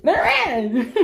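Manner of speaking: high laughing singsong taunt; real delighted laughter at the spirits; gleeful laughing. Real, not acted. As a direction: cackle through it in glee.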